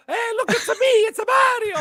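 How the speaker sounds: high, hammed-up Italian plumber; stupid Mario voice. Host mocks chat's request in a stupid Mario voice. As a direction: squeak it out like a cartoon Mario. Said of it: "I'll say it in a stupid Mario voice".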